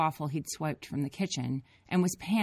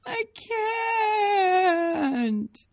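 high wailing cry; crying child; 8 dB louder than usual. A narrator's reading. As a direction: wail it like a despairing kid.

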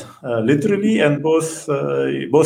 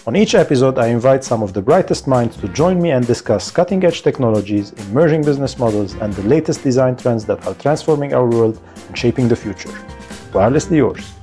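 upbeat, punchy announcer read; lively scripted intro with emphatic key words; podcast intro; 6 semitones lower, 4 dB louder, faster than usual. Acted. Host welcomes listeners to the tech podcast, upbeat announcer read.

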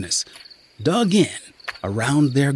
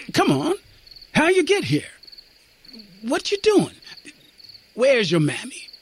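much higher, urging questions; coaxing sergeant; 10 semitones higher than usual. A narrator's reading. Sergeant pressing the lost boy for answers, coaxing.

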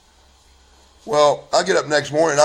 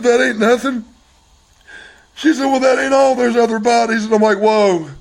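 high, loud voice straight after crying; real distress; 10 semitones higher, 5 dB louder than usual. Real, not acted. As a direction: push the story out in anguish. Distraught recounting the confession.

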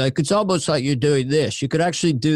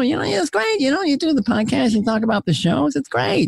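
very high-pitched talk show host; Jay Leno; 10 semitones higher than usual. Acted. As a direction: chatter repetitively in a high host voice. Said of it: "I could do Jay Leno too".

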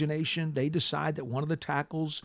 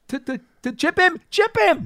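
chip him hit much higher and louder; emphatic insistence; 13 semitones higher, 8 dB louder than usual. Real, not acted. Host insisting tackles get help on Quinn, building emphasis. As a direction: drive the point home, building. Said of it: "host builds to an emphatic call".

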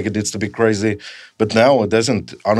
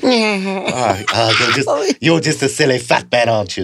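laughing cartoon voice; 2 semitones higher, slower than usual.

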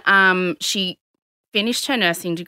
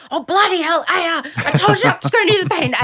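high, worked-up scolding; mock-exasperated outburst, pitched up; exasperated mother; 8 semitones higher than usual. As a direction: imitate a mum losing it at her child.

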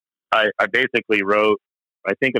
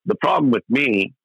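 usual speaking voice

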